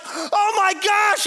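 high, stretched-out astonished shout; freaking out. Retold or imitated. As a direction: cry out like you can't believe it. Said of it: "So he's freaking out".

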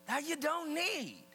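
high, loud sermon voice; emphatic preaching. Real, not acted. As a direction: preach it to the whole room with punch. Preaches at full voice.

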